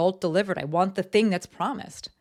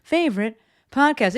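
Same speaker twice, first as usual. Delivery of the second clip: excited podcast welcome; 7 semitones higher, 6 dB louder than usual. Real, not acted. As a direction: greet listeners with big, raised energy. Host opens podcast with greeting.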